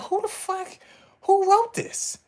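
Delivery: high, baffled mock outrage at the writers; incredulous outburst. Streamer reacting to an absurd Bollywood fight scene, high and incredulous. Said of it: "I'm like".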